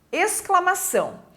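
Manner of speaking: ordinary talk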